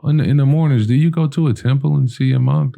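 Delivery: bursting to know if it's true; excited. Retold or imitated. On the phone to Tiffany about the monk, eagerly excited.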